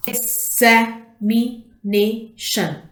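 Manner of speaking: "Examination" slowly as ex, a, mi, na, tion; five separate beats on "Examination"; split into syllables. Shown as a demonstration. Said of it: "five syllables".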